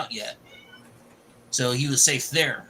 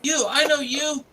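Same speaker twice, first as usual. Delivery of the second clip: higher-pitched acted accusation; retold confrontation; 10 semitones higher than usual. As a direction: call him out in a raised pitch. Retold character confronts someone he recognises.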